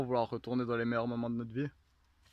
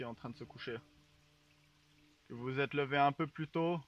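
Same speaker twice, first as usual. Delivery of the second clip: guided meditation; 5 dB quieter than usual. Acted. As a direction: lull them into calm. Leads a mock guided meditation.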